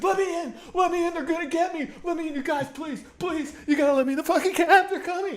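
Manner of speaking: high panicked begging, words rushing; desperate pleading. Retold or imitated. Man running from attackers begging the cab riders, panicked pleas. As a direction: beg for your life at the cab door.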